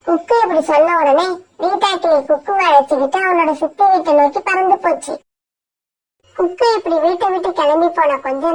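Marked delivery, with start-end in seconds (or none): none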